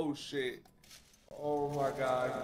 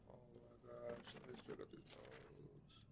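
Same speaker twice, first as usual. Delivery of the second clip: tense whisper; 3 semitones lower, 22 dB quieter than usual. Real, not acted. Sneaking in horror game.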